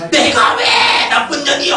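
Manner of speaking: high, loud mock indignation; hammed-up offended outburst; indignant retort. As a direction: snap back as if insulted. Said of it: "이렇게 나온단 말이에요".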